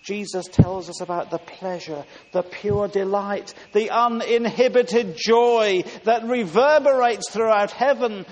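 exultant preaching. Acted. Preacher telling the congregation of heaven's joy, exultant. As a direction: build to a ringing proclamation of joy.

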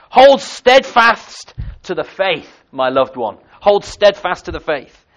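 loud, high cry, slow and emphatic; shouted exhortation. Retold or imitated. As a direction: call it out to a loved one across a crowd. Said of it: "she shouted to him".

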